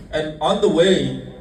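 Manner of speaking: slow, measured and on the way; drawn-out and on the way with a falling end. Shown as a demonstration. Preacher setting up the story, slowly.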